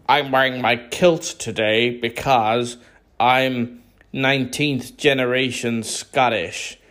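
mocking American. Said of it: "do Americans go".

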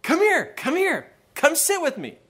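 high-pitched friendly call-over; imitated boy hailing another kid. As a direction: call someone across a bus, voice way up. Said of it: "he called out the bully's name".

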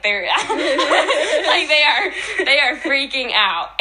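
high, loud, laughing through the words; laughter breaking up an excited retelling; laughing excitedly. Real, not acted. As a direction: giggle while describing the panic. Retelling apostles' panic, laughing.

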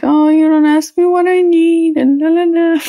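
hammed-up whiny complaint; whining. Wife imitating her own whining at husband, mock whine. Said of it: "I started whining".

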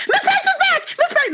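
put-on very high, thrilled child shouting her name; excited kids. Kids greeting their returning teacher, high excited cheer. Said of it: "And they're like".

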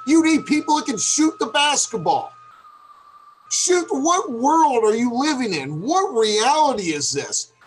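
disbelief at the front office; ranting. Real, not acted. Host ranting at the Cavs front office, incredulous. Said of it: "That's calling out the incompetence".

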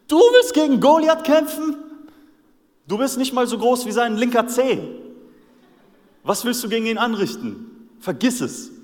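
viel höher, lauter ungläubiger Hohn; David auslachen wie einen Winzling; spöttisch herablassend. Retold or imitated. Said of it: "alle fangen an zu lachen". (die Krieger verhöhnen David lauthals)

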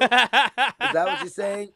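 slow, high laughing question. Real, not acted. Laughing at misheard words.

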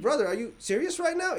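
high, disbelieving question; incredulous. Retold or imitated. Driver asking the officer in disbelief, pitched high. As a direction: ask it in shock, pitch up. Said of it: "I was like".

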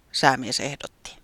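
plain reading voice

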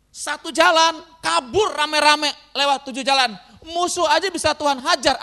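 loud, emphatic pulpit proclamation; forceful preaching. Real, not acted. Preacher assuring the congregation God routs every locust, forceful. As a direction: build up to a booming declaration of faith.

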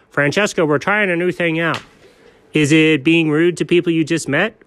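ordinary talk

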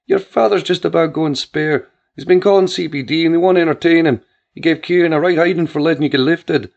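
a frantic ma hearing her son's voice; mother near tears on the phone; worried Irish mother. A narrator's reading.

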